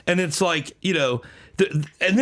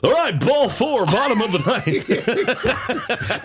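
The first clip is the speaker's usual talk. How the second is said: high, slow, drawn-out game call; fake-cheerful announcer; 5 semitones higher, much slower than usual. Grieving announcer back calling the game, hammed-up hype. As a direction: belt it out like a cheesy baseball broadcaster. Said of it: "act like everything's great".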